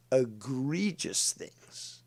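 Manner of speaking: ordinary delivery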